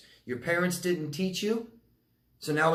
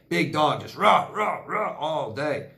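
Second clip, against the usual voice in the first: dog bark imitation; slower than usual. Imitating neighbor's barking dog.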